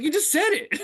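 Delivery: high-pitched disbelieving retort; mock incredulous comeback. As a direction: act out a baffled protest, voice jumping way up. Retells their incredulous comeback.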